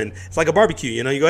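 usual speaking voice

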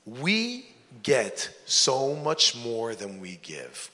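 ordinary delivery

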